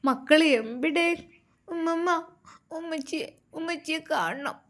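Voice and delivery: put-on crying child. A narrator's reading. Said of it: "അത് പറഞ്ഞ് കരയുന്നു".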